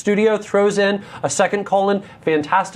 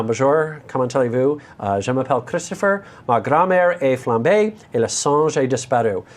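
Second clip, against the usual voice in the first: French in a heavy American accent; horrible American accent; 5 semitones lower than usual. Shown as a demonstration. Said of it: "horrible American accent way of saying".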